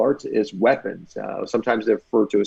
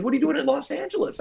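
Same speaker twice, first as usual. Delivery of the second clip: curious stuntman; 14 semitones higher, faster than usual. Retold or imitated. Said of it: "He goes".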